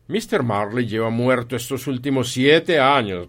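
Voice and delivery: loud, emphatic reply; stern Scrooge. Acted. Scrooge corrects the visitors about his partner, stern and loud. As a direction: state it firmly and loudly.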